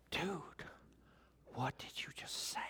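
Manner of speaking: hushed, breathless whisper of disbelief; shocked whisper. Retold or imitated. Disciples whispering to each other after Jesus' sermon, shocked. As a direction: whisper it like you can't believe your ears. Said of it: "They were like".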